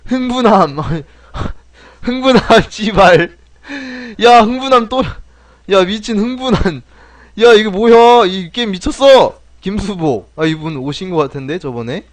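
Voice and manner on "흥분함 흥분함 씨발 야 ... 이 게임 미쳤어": loud, high-pitched real disbelief with laughter; incredulous outburst. Real, not acted. Streamer reacts to absurd award name, loudly incredulous. Still stunned by absurd game text.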